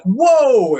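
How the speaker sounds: retold jolt of alarm; startled whoa. Startling message breaks into their thoughts.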